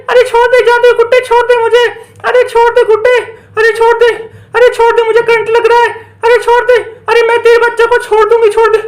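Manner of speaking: high, acted cries of a shocked witch; pleading in pain. Witch electrocuted by magic doll, panicked pleading.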